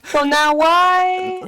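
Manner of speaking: very slow, high drawn-out question; mock questioning. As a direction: stretch the final word in challenge.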